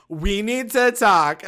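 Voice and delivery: imitating a friend's dramatic announcement; mock serious Chloe. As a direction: drag it out like a warning.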